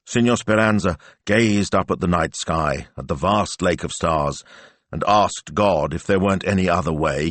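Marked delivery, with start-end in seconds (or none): none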